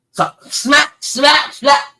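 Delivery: loud, high smack, smack, smack; smack sounds. Retold or imitated. Father acts out belting his kid, loud mock smacks. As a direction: slap out each smack hard. Mimics belt hits.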